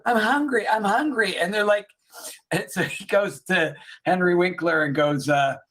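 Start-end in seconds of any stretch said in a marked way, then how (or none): 0.1-1.4 higher, perked-up imitation of a girl; brighter. Retelling the cured little girl feeling hungry, brighter. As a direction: play a little girl suddenly perking up. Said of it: "like, brighter".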